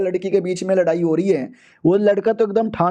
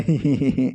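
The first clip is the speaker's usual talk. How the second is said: a short, low chuckle under the breath; soft low chuckle; 7 semitones lower than usual. Acted. Hero laughing at the rival's dowry offer, low chuckle. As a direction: laugh quietly to yourself. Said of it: "हंसने लगता है".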